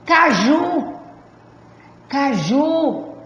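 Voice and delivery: "Caju" with a held, emphatic "ju"; stress on final syllable. Shown as a demonstration. Teacher showing students the tonic syllable, stress on "ju" in "Caju". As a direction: hit and stretch the "ju" in "Caju". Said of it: "A sílaba tônica é o ju".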